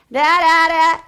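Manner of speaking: loud, high droning read-aloud. Shown as a demonstration. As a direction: blare it out like mechanical reading. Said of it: "this is her reading voice".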